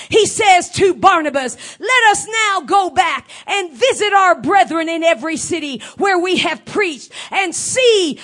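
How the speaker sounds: loud, rising fervent preaching; evangelical preacher. Real, not acted. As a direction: preach it with full fervor. Preaching scripture at full force.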